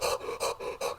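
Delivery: soft, breathy panting gasps; imitated hard breathing. Retelling how the character was breathing hard, imitated panting. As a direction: pant as if out of breath. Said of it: "he was breathing hard".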